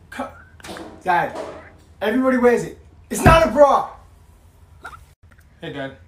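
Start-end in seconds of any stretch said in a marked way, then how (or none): 1.0-2.8 rising, higher and louder protest; exasperated yell. Acted. Teen protesting to her dad about her top, building to a yell. 3.1-3.9 high loud yell on not; shouted protest. Acted. Teen finally yelling at dad, high and loud.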